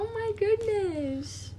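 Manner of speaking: high, soft, drawn-out coo; tender high-pitched fawning. Real, not acted. Cooing over a child's birthday.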